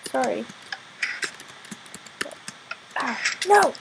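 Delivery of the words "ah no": higher, louder frustrated cry; annoyed outburst at failing. Real, not acted. Fails jump, frustrated.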